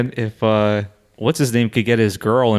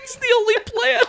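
squealing with laughter, much higher; laughing hard. Real, not acted. Reacting to the corner bit, laughing hard. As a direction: crack up while talking.

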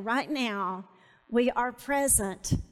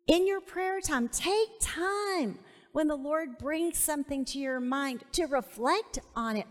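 ordinary talk

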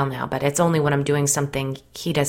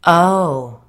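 drawn-out, loud dawning response; slow reply; 3 semitones higher, 9 dB louder than usual. A narrator's reading. Jason taking in Biddy's explanation, slowly. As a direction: stretch it out slowly as it sinks in. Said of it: "Jason replied just as slowly".

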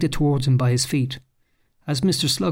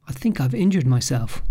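plain reading voice

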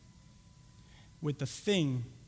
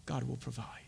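very soft, low whisper; 5 semitones lower, 8 dB quieter than usual. Acted. Abraham to his son before the sacrifice, whispered. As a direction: breathe it out under your voice. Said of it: "Just whisper it".

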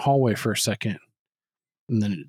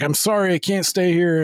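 mock panicked apology; 6 semitones higher than usual. Retold man fleeing in panic.

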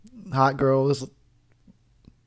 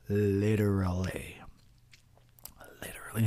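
whispered aside; 4 semitones lower, 7 dB quieter than usual. Real, not acted. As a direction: whisper it as a secret. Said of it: "whispered aside".

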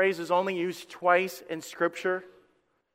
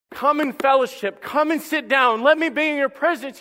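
earnest call; 6 semitones higher, 6 dB louder than usual. Acted. God voiced pleading, louder.

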